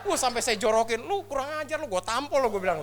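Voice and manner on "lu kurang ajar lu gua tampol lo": high, comic threat; mock scolding. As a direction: tell off a cheeky hugger in mock outrage. Retells scolding the person hugging him.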